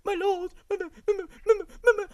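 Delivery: very high, whimpering child imitation; squeaky mock-child babble; mock little boy. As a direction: imitate a tiny pleading kid. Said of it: "his, his little, like".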